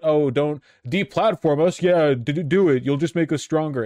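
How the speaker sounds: goading, put-on crabbing; mock alt-right crabbing. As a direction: exaggerate their complaint to ridicule it. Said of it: "alt-right crabbing about".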